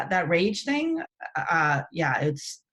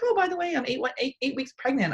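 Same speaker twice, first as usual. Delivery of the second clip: higher-pitched imitation of a friend; casual friend's announcement; 7 semitones higher, faster than usual. Best friend dropping pregnancy news, imitated high and casual. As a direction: toss off big news in a high voice.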